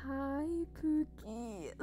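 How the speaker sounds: hugely drawn-out, stalling hello; awkward cover-up greeting. Acted. Streamer greeting someone whose name they can't say, awkwardly drawn out. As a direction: stretch the greeting to dodge a name. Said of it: "I don't know how to say their name, and I'm like".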